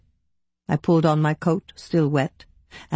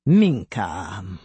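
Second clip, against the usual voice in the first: low, drawn-out echo of the name; superior echoing a name; 8 semitones lower than usual. A narrator's reading. Officer saying his secretary's name back, low and measured. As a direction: repeat the name lower, as the officer trying it.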